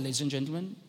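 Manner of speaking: low, soft cabin announcement; calm captain's voice. Retold or imitated. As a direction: imitate an unruffled pilot on the intercom. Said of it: "he speaks in a calm voice".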